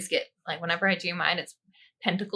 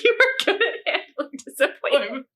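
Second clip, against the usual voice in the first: giggling, voice up high; comforting through laughter; laughing; 9 semitones higher, 3 dB louder than usual. Real, not acted. Laughing as she reassures her friend about the card.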